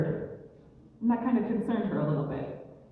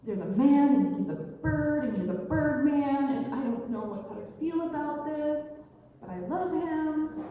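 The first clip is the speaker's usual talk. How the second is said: higher-pitched, bewildered rambling; acted confusion in a higher voice; confused; 6 semitones higher than usual. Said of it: "she's confused".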